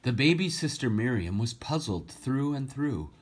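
lively storybook reading, rhyming lilt; story reading. A narrator's reading. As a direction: read the verse aloud to children with feeling. Rhyming story read aloud.

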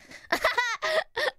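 high-pitched burst of laughter. Real, not acted. Streamer amused by a sweet chat message, high laugh.

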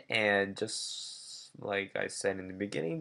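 ordinary talk, nothing marked

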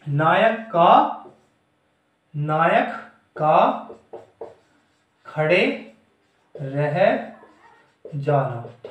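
word by word, long pauses between; slow dictation. Shown as a demonstration. Teacher dictating a definition to students, slow dictation. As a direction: dictate it slowly, each word set apart.

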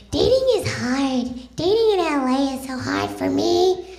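mock a complaining LA woman, whining; whiny LA woman. Comic mocking women who whine about dating, high whiny voice. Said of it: "Yes, with that voice".

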